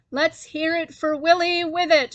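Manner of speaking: mayor cheers for Willie, loud shout. A narrator's reading. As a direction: rouse the crowd like a mayor. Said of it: "shouted the mayor".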